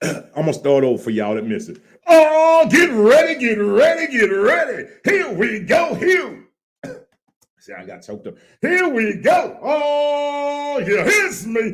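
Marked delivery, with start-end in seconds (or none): 2.1-11.6 high, loud, stretched-out show opener; hype announcer intro. Acted. Host opening the live show for TikTok in a high hype announcer voice. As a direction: crank up the crowd like a ring announcer.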